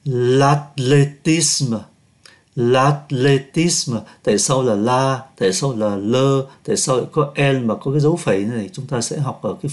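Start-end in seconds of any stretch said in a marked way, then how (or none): 0.1-4.0 "L'athlétisme" very slow, H silent; stretched model reading of "L'athlétisme"; slow French demo. Shown as a demonstration. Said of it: "ký tự H không phát âm". (đọc mẫu thật chậm "L'athlétisme", câm chữ H)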